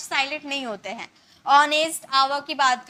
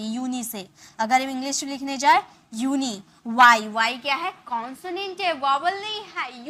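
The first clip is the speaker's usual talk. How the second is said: ordinary talk, nothing marked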